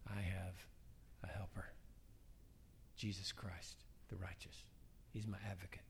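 very soft and low, with pauses; hushed, unhurried reliance on a helper; humble confession. Acted. Believer standing before God naming an advocate, hushed humble confession.

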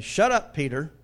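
loud, forceful rebuke; stern God. Acted. God telling Peter to stop talking, stern loud rebuke. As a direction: lay down the order to a rambler. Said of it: "What's God saying?".

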